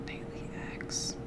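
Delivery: breathy whispered aside; hushed little whisper. Acted. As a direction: whisper it to yourself. Whispered item name aside.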